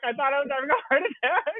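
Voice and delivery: high, plaintive rising apology; whiny apology. Retold or imitated. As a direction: plead it, ending on a rise. Repeating the apology, more plaintive.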